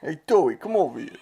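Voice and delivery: hammed-up New York vendor calling out; mock New York accent. Co-host joining in as the food-stall guy, mock New York accent. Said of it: "turned into a New York accent".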